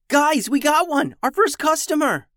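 high, loud, thrilled cry; excited shout. A narrator's reading. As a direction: burst out with joy. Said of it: "shouted Beatrice".

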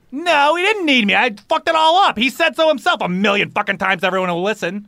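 high, heated outpouring; angry, worked up. Acted. Pally insists little brother never needed him, angry. Acted quarrel between brothers.

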